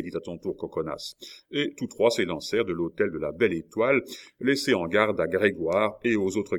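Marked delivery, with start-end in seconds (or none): none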